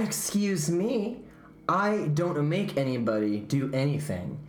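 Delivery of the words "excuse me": offended. Acted. Offended teacher taken aback.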